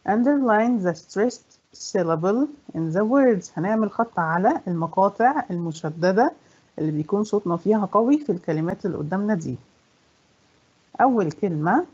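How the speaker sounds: ordinary talk, nothing marked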